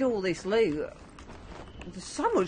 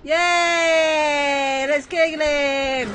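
excited cheer; 3 semitones higher, much slower than usual. Real, not acted. Cheering to attack.

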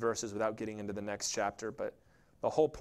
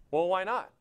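ordinary talk, nothing marked

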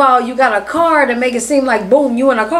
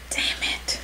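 hushed frustrated whisper; whispered curse; 14 dB quieter than usual. Real, not acted. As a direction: whisper it under your breath. Struggling with food, muttered.

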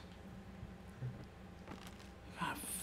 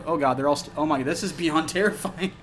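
ordinary talk

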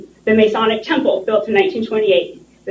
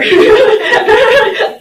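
embarrassed laugh; 12 semitones higher, 7 dB louder than usual. Real, not acted. Calling her map amateur.

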